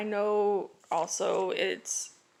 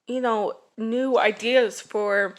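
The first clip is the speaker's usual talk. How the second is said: ordinary delivery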